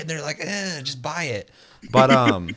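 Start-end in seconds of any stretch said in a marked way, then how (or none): 0.4-1.5 slow, lazy brush-off; dismissive shrug. Retold or imitated. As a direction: shrug it off like a careless company. Company's dismissive answer.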